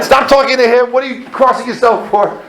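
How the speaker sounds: high, exasperated comic telling-off; acted panicked scolding. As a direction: scold the praying stewardess in a panic. Scolds praying stewardess.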